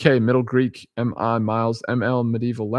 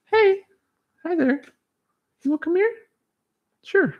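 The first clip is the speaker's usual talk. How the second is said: very high-pitched coaxing of a cat; high little invitation to the cat; pet talk; 3 dB louder than usual. Real, not acted. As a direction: squeak it sweetly to a pet.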